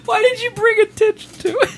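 laughing whine. Real, not acted. Laughing at friend's vape.

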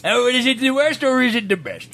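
put-on British accent as if bee-stung in the mouth; bad British accent. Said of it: "It sounds like you've been stung".

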